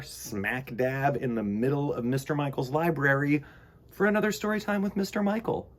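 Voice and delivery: plain reading voice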